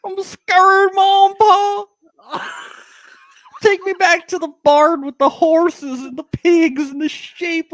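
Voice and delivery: high, teary fake sobbing plea; hammed-up weepy groom begging his parents; mock crying groom. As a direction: wail like a panicked little boy. Mocking the scared groom crying.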